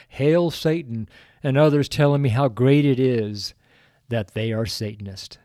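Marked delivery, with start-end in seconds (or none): none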